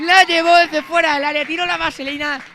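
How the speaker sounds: loud, high excited goal call; breakneck goal commentary. Real, not acted. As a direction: ride the goal euphoria. (narración eufórica del golazo)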